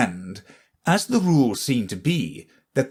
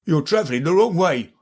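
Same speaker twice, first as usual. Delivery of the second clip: guard's voice; 6 semitones higher, faster than usual. A narrator's reading. The guard speaks.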